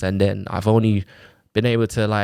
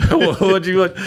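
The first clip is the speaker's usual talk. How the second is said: half-laughing, louder disbelief at her backing out; incredulous retort; 8 semitones higher, 5 dB louder than usual. Retold or imitated. Father telling the mother she already signed, incredulous and laughing. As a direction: throw it back at her with a laugh, higher and louder.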